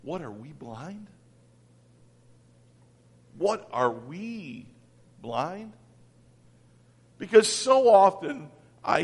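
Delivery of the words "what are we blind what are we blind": much softer, lower, slowed self-question; quiet wondering. Real, not acted. Preacher quietly turning the taunt on himself, hushed. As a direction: ask it softly to yourself. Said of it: "I sometimes think".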